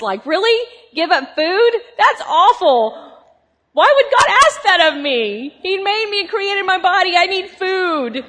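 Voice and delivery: a hammed-up protest against going hungry; incredulous complaint. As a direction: whine it like fasting is unthinkable. Recalling her old view of fasting.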